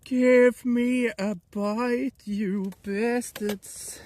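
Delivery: very slow, high, loud drawn-out demand; dragged-out plea. Pretend. Streamer yelling at the fish under the ice, slow high plea. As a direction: stretch every word at the fish.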